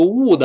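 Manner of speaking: "would" with a tight long "oo"; loud wrong example, "would" with an oo vowel. Shown as a demonstration. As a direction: mispronounce "would" as "woo-d". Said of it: "不要读would啊".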